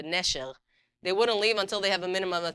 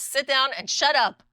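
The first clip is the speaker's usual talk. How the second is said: loud, slow, higher barked command; curt rude order; 7 semitones higher, 8 dB louder than usual. Retold or imitated. Stewardess orders frightened passenger: loud, slow curt command. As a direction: snap at the nervous passenger to sit. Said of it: "in her wonderful Russian courtesy".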